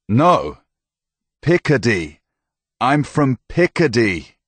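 careful Picardy, every syllable of Picardy spoken clearly; enunciating carefully. Acted. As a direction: spell out I'm from Picardy syllable by syllable. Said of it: "careful to enunciate every syllable".

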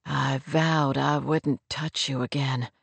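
low, soft male voice; male lead's confession. Acted. Man confesses a broken vow.

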